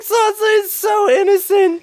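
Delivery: very high, drawn-out mock squeal; mock exclamation. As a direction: draw it out loud and sky-high, imitating a reaction. Voicing a reaction to a show character.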